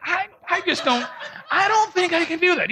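high-pitched, laughing mock whine; comic panicky refusal; incredulous protest.

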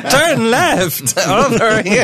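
slow robotic sat-nav voice; gps voice. Retold or imitated. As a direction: read it out like a car GPS. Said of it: "She sounds like a G P S".

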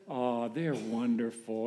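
soft, slow, dreamy gush; a mock-smitten sigh of adoration; lovestruck coo. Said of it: "And you kinda talk like that".

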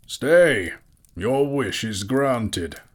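high, ringing call, then slow; commanding old wizard. A narrator's reading. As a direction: call a halt, then pronounce it slowly. Mysterious old man calls out.

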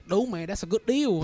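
Vietnamese accent. Shown as a demonstration. Retelling what he says to coworkers, Vietnamese accent. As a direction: put on a Vietnamese accent for the phrase. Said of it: "with a Vietnamese accent".

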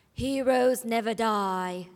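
slow, steady delivery; low and slow hero line. Shown as a demonstration. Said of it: "I was at a higher pitch".

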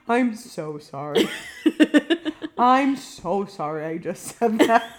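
slow, sorry words broken by laughter; laughing apology. Retold or imitated. Recalling her embarrassing remark, a slow apology through laughter. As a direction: apologise while giggling at yourself. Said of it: "looked at the ground and was like".

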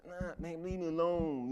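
high, whiny plea to be left alone; pouty. Retold or imitated. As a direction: whine it like a kid who won't fight back. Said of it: "you know, just really pouty".

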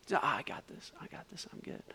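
acting half-asleep, fading almost to nothing; drowsy mumble. Drifting-off driver brushing off his worried friend, drowsy mumble. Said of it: "drift off a little bit".

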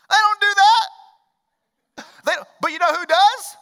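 slow, loud, high, hammering each word; preaching shout. Real, not acted. Preacher to congregation, contrasting the Marines: booming slow shout. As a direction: bring the full weight of the pulpit down on it.

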